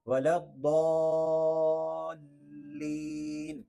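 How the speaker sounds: "الضالین" with daa held very long; long held daad. Shown as a demonstration. Teacher models for learners, hugely lengthened "الضالین". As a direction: stretch "الضالین" steadily into the laam. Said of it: "ضاد کو لمبا کرنا ہے".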